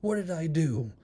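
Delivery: high, fretful retold questioning; mock paranoid. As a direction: play a dude anxiously pleading ignorance. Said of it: "all paranoid".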